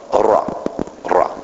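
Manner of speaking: Ra with a trilled R, short and clipped; rolled R. Shown as a demonstration. Preacher teaching the congregation a Hebrew word, rolled R. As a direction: roll the R hard on Ra. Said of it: "Can y'all roll your R?".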